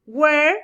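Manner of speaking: Where said slowly and clearly, rising at the end, as the model; clear pronunciation demo. Shown as a demonstration. Teacher models Where for her students, clear and careful. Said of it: "coloqué su pronunciación porque es muy importante".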